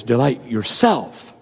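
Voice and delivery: "yourself" slow and drawn out in a low voice; musing, lean heavily on "yourself"; low and deliberate, weight on "yourself"; stress on YOURSELF. Shown as a demonstration. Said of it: "emphasize a different word each time".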